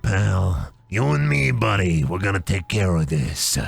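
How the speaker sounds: a hint of a chain-smoking mentor on a stump; smoker mentor. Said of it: "smoking a cigarette, sitting on a stump".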